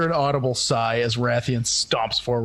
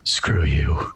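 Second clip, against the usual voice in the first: low, menacing whisper in its ear; 11 semitones lower than usual. Acted. Limping fighter leaning over to his foe, whispering. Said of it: "leans over and whispers in its ear".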